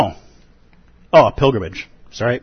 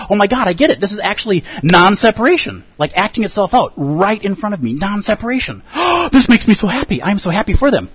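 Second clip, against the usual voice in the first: high, gushing delight; like someone suddenly getting it all, half-acted; overjoyed realization; 9 semitones higher than usual. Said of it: "Some part of us just goes".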